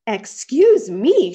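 loud, slow, affronted outburst; indignant shout. A narrator's reading. Rabbit grabbed by a bear, shouting indignantly. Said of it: "shouted the rabbit".

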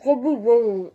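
cheeks crammed, words barely shaped; mouth full. Real, not acted. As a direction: mumble through a packed mouth.

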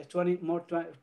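ordinary talk, nothing marked

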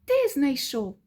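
ordinary delivery, nothing marked

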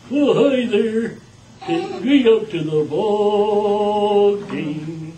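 high, slow, loud dummy voice; sing-song puppet squeak; squeaky ventriloquist dummy. Shown as a demonstration. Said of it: "that's a ventriloquist puppet".

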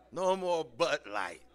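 usual speaking voice

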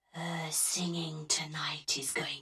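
low, slow unvoiced menacing whisper; ghostly whisper. A narrator's reading. The ghost warning the directors, low sinister whisper. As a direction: breathe the threat from everywhere. Ghost's voice all around them.

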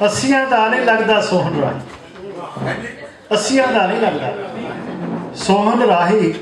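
lilting mushaira couplets; poem recitation. Acted. Poet teasing his eighty-year-old friend in verse, lilting recitation. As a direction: recite line by line with pauses for the audience.

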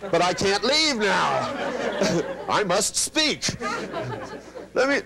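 high, laughing, pleading ramble; mock rambling Dale. Dale won't stop talking, laughing, high and pleading. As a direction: play Dale babbling on through laughter. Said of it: "Dale goes".

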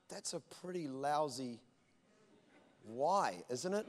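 a hint of God teasing him quietly; lightly voiced gentle God. God gently chiding the pastor, low and soft.

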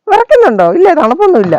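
high doting question to a pet; pet talk. Real, not acted. Owner asks her shivering dog if it's cold, high pet talk.

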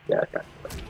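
ordinary delivery

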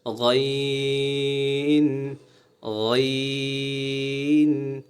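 গইন with a gargled gh and long drawn vowel; gargling sound. Shown as a demonstration. Teacher showing the letter ghain, gargled sound. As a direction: stretch গইন from a gargle in the throat. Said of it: "গড়গড়া করার মতন আওয়াজ".

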